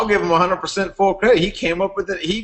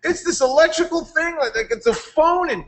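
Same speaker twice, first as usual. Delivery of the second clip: much higher, hyped-up pitch; excited Aaron impression; 13 semitones higher, slower than usual. Aaron pitching his latest company to Rich, hyped-up. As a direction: play an overexcited friend pitching a deal. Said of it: "Aaron would just pop up".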